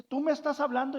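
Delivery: lightly acted bewilderment; startled old lady. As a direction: ask in mild disbelief. Old lady startled by talking frog.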